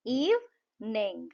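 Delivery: इवनिंग very slow, इव, निंग; two separate beats on इवनिंग; syllable by syllable. Shown as a demonstration. Teacher repeats two claps in इवनिंग, syllable by syllable. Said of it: "इवनिंग में दो है".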